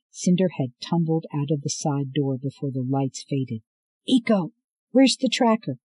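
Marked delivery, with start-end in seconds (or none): 4.1-5.8 high, loud hurried question; urgent demand. Acted. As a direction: demand it fast.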